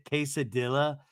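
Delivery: "quesadilla" with the L's sounded, kay-suh-DILL-uh; mispronounced quesadilla. Retold or imitated. Mary mangles her order, "quesadilla" with hard L's. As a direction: say "quesadilla" ending in "dilla" instead of "dee-ya". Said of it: "Mary doesn't know how to say quesadilla".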